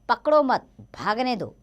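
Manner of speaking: पकड़ो मत said as one loud high unit, then भागने दो; pause after मत. Shown as a demonstration. Teacher shows pupils how punctuation changes meaning, pause after मत. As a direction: break after मत, grouping पकड़ो with मत. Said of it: "इन वाक्यों को ध्यान से पढ़ते हैं".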